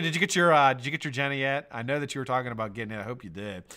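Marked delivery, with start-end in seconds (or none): none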